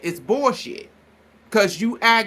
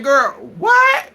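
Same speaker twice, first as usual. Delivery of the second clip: loud, high, incredulous outburst; acted disbelief; 6 semitones higher, 7 dB louder than usual. Retelling a friend's reaction to being called jealous, incredulous. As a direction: act out her shock, pitched up and loud.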